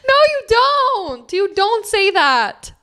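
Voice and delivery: high, drawn-out disbelieving denial; incredulous protest. Real, not acted. Friend disputing a pronunciation, incredulous protest.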